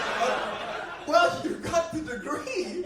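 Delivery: high, slow mock deference; sarcastic surrender. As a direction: give in with irony. Resigned deference to doctor.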